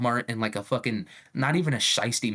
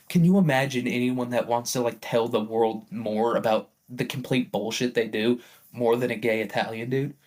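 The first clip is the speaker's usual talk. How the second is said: normal talking voice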